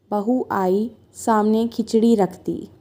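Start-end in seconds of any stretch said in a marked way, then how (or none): none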